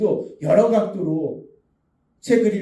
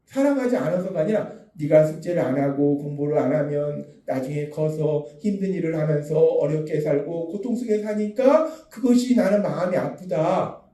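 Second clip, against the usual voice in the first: ordinary delivery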